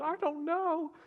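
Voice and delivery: a mock tearful husband answering his wife; imitating tearful. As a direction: squeak it out like a man crying. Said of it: "and he just starts crying".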